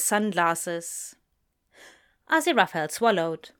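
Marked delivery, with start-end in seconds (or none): none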